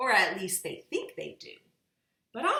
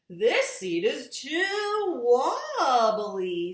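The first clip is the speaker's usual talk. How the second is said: character voice; 6 semitones higher, 8 dB louder, much slower than usual. A narrator's reading. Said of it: "said somebody".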